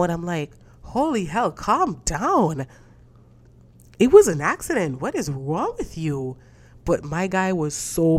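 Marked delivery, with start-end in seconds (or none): 0.8-6.4 exasperated retort. Retold or imitated. Telling how she answered the pissed friend, exasperated. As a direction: act out an over-the-top protest, pitch swinging up and down.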